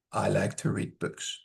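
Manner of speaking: low, soft monotone; very flat. Shown as a demonstration. Teacher showing students poor intonation, very flat. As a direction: drone it with no stress at all. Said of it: "Very flat".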